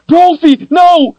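high, loud, desperate scream; screaming warning. A narrator's reading. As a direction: scream at the wolf to flee. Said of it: "Kalen screamed".

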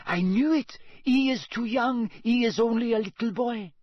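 put-on high-pitched, scornful young champion; Fleur Delacour. A narrator's reading. Harry imagines a rival champion judging him, high and scornful. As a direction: raise the pitch, dismissing him as a child. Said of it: "hear Fleur Delacour saying".

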